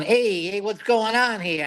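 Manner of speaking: a hint of New York Brooklyn swagger; mock Brooklyn accent. Critic mocking the actor's Brooklyn accent in Rome, light Brooklyn accent. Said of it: "not a fan of his Brooklyn accent".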